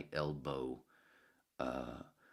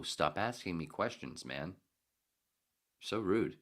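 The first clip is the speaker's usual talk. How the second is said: ordinary talk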